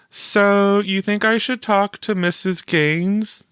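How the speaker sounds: put-on a bright young girl asking; Teresa voiced. A narrator's reading.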